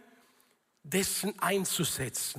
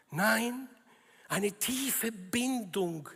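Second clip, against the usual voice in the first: ordinary talk, nothing marked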